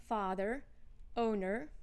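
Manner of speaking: "father, owner" with the final R pronounced; rhotic-accent "father, owner", R sounded; rhotic word endings. Shown as a demonstration. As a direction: curl an R onto the ends of "father" and "owner". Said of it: "sound like with a rhotic accent".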